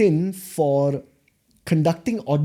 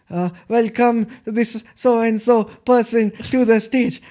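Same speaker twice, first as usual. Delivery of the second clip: awkward, higher-pitched mock stage welcome; forced enthusiasm; 6 semitones higher than usual. Trainee copying the mentor's stage introduction, awkwardly forced enthusiasm. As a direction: overdo the MC introduction, awkwardly upbeat. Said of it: "say it with a lot of enthusiasm".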